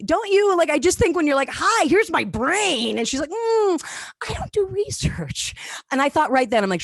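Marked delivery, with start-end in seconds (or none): 1.5-3.0 drawn out, slow and high; mock-earnest slow offering; slow eager offer. Acting herself eager with therapist. 3.3-5.5 high, slow polite brush-off; lightly voiced cautious therapist; imitating therapist.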